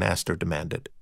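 ordinary delivery, nothing marked

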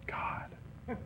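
hushed, breathy exhale of mock despair; whispered mock-exasperated groan; fake exasperation. Shown as a demonstration. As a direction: let it out as a long exasperated sigh. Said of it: "Fake exasperation".

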